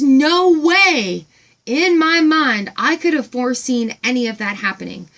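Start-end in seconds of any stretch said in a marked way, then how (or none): 0.0-4.0 no way in my mind stretched out, louder and higher; slow amazed emphasis. Real, not acted. Telling her audience how amazed she was, slow and emphatic. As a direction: lean into the amazement slowly.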